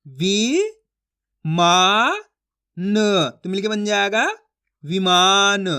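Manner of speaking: long, separated syllables, then the blended word; drawn-out reading demonstration. Shown as a demonstration. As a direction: sound it out piece by piece for learners. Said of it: "मैं किस तरीके से एक एक अक्षर का उच्चारण करके पढ़ रहा हूँ".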